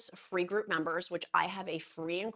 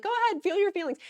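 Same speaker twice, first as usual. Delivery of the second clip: cheering viewers; 13 semitones higher, 8 dB louder than usual. Retold or imitated. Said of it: "I know you guys are like".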